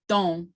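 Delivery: don with the O said through the nose, no real N; nasalized Kiowa vowel; nasal O. Shown as a demonstration. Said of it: "so it's nasal".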